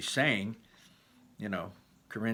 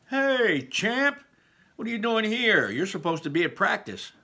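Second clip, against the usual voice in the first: much higher, louder booming greeting; hearty dad; 11 semitones higher, 5 dB louder than usual. Acted. Dad calling to his son from the truck, high and loud. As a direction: hail your kid like a proud coach.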